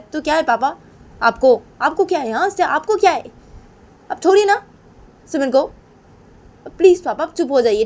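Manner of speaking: daughter protesting to her father, rising then subsiding; exasperated pleading daughter. Acted. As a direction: beg him crossly to stop. Plays Sunaina answering father back. (पिता से चिढ़कर विनती करती बेटी)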